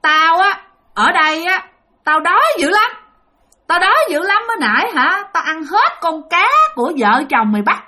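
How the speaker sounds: high-pitched demanding ghost; possessing spirit. Retold or imitated. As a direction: complain of hunger in a high ghost voice. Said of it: "ba của bạn mới nói rằng".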